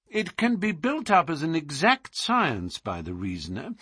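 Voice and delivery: musing detective. Acted. Detective marvelling at a rose before puzzled clients, musing. Holmes muses aloud on the rose.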